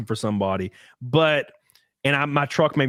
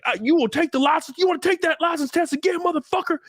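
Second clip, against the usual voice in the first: much higher, raging mock yell; imitating cop's screaming; 12 semitones higher than usual. Cop screaming at teen over a tag light, raging yell. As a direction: taunt him like a furious cop. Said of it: "he started screaming and spitting in my face".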